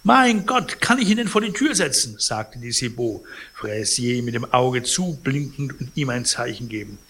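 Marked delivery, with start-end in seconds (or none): 0.0-2.2 flustered concierge. Acted. Concierge feigns helplessness.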